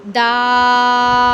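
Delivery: दाल, long drawn aa; long Arabic vowel. Shown as a demonstration. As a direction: stretch दाल out evenly. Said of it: "तीन अलिफ जितनी इसकी मिकदार है".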